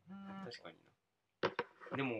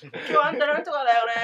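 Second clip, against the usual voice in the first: much higher, loud auntie voice; a chatty old lady prattling; 12 semitones higher, 14 dB louder than usual. Retold or imitated. Old lady chattering while cooking.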